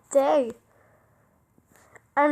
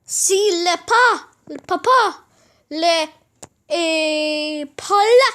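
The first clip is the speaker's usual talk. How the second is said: higher, louder, fast gibberish scold; 5 semitones higher, 6 dB louder than usual. Acted. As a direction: snap at father in a made-up tongue. Jack scolds father in gibberish.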